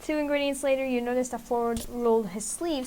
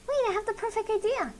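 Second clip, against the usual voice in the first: put-on much higher, sing-song matchmaker girl; bubbly Mabel voice; 10 semitones higher than usual. Mabel teasing Ford and Sylvia while baking, high and gushing. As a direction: pitch it way up, gushing and delighted.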